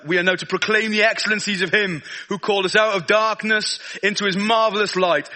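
ordinary talk, nothing marked

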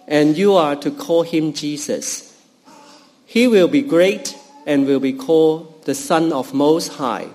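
normal talking voice

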